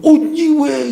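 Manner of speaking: sudden high startled yelp, imitating friends. Pastor imitating friends startled by his limp, surprised. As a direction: mimic a friend who is caught off guard. 우리 친구들 한 번씩 보면.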